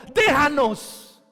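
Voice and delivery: loud, high, slow déjanos; preacher voicing the uncomfortable demon begging Jesus to leave; demon pleading. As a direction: beg and howl as a demon. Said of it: "Lucas dice que el demonio dijo".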